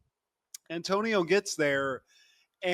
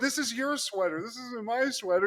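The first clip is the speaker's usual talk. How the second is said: high-pitched mock exasperation; acted bickering; 6 semitones higher than usual. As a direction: argue back in a hammed-up squabble. Acting out a couple's quarrel.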